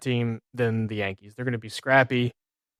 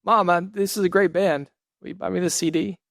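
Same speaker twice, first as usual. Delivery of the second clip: higher-pitched plea to mom; pleading kid; 7 semitones higher than usual. Retold or imitated. Kid asking mom to buy a CD, pleading.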